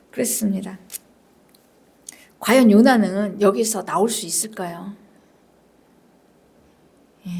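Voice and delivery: ordinary talk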